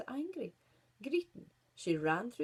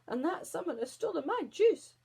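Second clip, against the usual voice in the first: high, louder wail of outrage; howled; 8 semitones higher, 6 dB louder than usual. Acted. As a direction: howl about the stolen juice. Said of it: "howled Crabbit".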